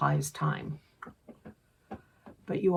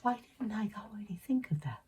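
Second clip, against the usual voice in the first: whispery, quick tiny inner voice; tiny voice, hushed and hurried; little tiny voice; 4 semitones higher, 8 dB quieter, faster than usual. Acted. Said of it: "there's this little tiny voice saying".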